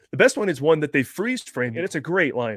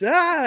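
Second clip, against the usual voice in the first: sharp high mock shriek; imitating Willie's startled scream; 6 dB louder than usual. Willie grabbed in the hole, mock scream. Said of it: "And she goes".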